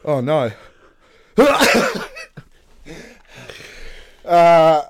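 ordinary talk

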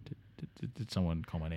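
muttering dim guest; Delaware Dave. Acted. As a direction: drift in like a sleepy guest. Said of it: "Delaware Dave cuts in".

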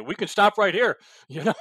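ordinary talk

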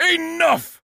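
high, loud cry to stop it; commanding shout. Acted. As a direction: shout to halt a fight. Crowd scene, someone breaks it up.

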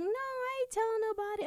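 high, put-on mom voice insisting; imitating mom. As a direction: squeak out mom's denial. Imitating mother denying it.

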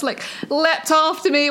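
normal talking voice

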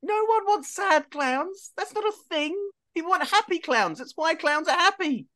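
very high, incredulous arguing; exasperated. Acted. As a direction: protest in a high, disbelieving voice. Acted argument with the clown.

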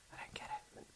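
whispered aside; breathy whisper, no voice. Real, not acted. Unvoiced aside.

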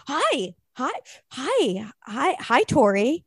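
put-on phone greeting, stumbling into it; his phone voice. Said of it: "always has the phone voice".

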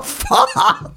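a very high, shrieked "Faen!"; angry yell. Retold or imitated. Clutching a tiny towel, shrieking "Faen!" at the TV crew. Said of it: "Jeg var så sint".

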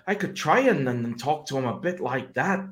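muddled, sing-song attempt at Norfolk; bad Norfolk accent. Shown as a demonstration. Trying a Norfolk accent on his mates' request, badly faked. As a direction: have a shaky go at a Norfolk accent, halting start. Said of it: "tried doing it in a Norfolk accent".

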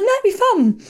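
high, loud imitation of an eager partner; mock breezy reassurance. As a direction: pitch it way up, mocking his enthusiasm. Partner's breezy reply, retold.

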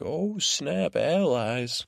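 high-pitched imitation of an astonished crowd; mock surprise. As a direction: react with a playful, hammed-up "whoa". Mimics everyone's stunned reaction to allies.

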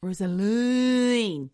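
Rosaline drawn out slowly. Shown as a demonstration. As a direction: stretch out Rosaline carefully. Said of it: "R O S A L E E N".

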